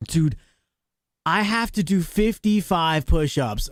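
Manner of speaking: normal talking voice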